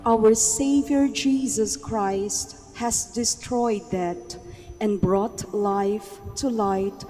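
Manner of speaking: ordinary talk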